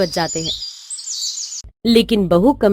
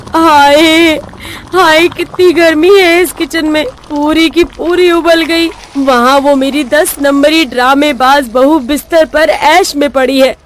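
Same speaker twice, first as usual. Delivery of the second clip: a higher-pitched moan about the heat; acted hot-and-bothered griping; exasperated complaining; 4 semitones higher than usual. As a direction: wail and grumble as if worn out. Overheated mother-in-law complaining in kitchen.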